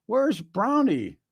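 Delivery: put-on high, slow child voice asking; little kid wondering where the dog went; child's question. Kid asking dad where the dog went, high and slow.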